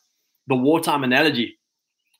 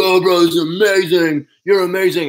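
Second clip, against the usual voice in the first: higher, gushing fan voice; mock gushing praise; 3 semitones higher, 4 dB louder than usual. Buddy gushing praise at the speaker, excited. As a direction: play the overexcited admirer. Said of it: "some guys will be like".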